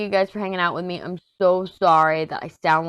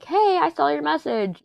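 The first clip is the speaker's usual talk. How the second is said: high-pitched, singsong acknowledgement; friendly little greeting; 11 semitones higher than usual. Pretend. Voicing the heart reply to a viewer, high friendly greeting. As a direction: pipe it up cheerily, well above normal pitch. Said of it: "And that's just like".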